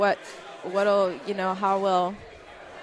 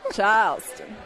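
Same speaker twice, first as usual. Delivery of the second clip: Charleston drawl with the R dropped; Charleston accent. Retold or imitated. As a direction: say "Charleston" in an old Southern accent. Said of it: "Sounds like Charleston".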